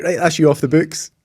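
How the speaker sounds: lightly acted cheery dismissal; brisk sign-off. Consultant signing him off the books, brisk send-off.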